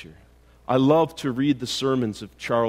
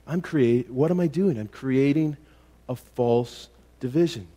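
normal talking voice

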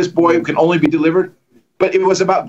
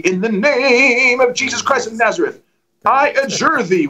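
theatrical exorcist; 6 semitones higher than usual. Retold or imitated. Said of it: "you get real theatrical".

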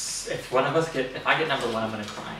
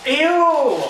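disgusted shriek; 10 semitones higher, 5 dB louder than usual. Real, not acted. Tasting gross food.

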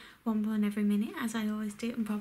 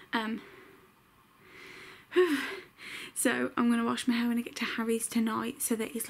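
ordinary talk

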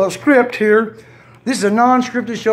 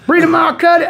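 loud, higher joking shout; mock shout; 5 semitones higher, 4 dB louder than usual. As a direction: call out the blame playfully. Shouted joke accusation.

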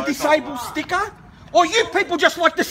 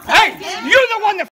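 higher-pitched, heated accusation; angry accusation; 6 semitones higher, slower than usual. Real, not acted. Woman in parking row blaming the other side, angry. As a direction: call them out in real anger.

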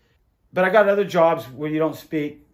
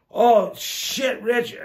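alarmed; 7 semitones higher, 4 dB louder, much slower than usual. Retold or imitated. Friend's alarmed reaction.

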